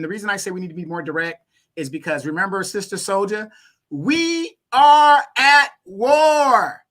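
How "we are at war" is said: loud, higher and very slow, each word hammered; urgent declaration. Real, not acted. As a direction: proclaim it like a rallying call. Urgent rallying cry to listeners.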